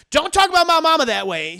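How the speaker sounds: loud, high, fast snap back; angry retort. Retold or imitated. Daughter snapping at her boyfriend over her mama, angry. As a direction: defend her mama hard. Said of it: "She's like".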